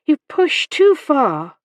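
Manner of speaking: high, loud, slow protest. A narrator's reading. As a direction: protest that he has gone too far. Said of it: "Hannah protested".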